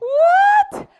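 shrill high scream of fright; terrified shriek. Acted. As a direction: shriek as if the rock god will eat you. Child scared of the rock god.

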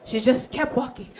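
ordinary talk

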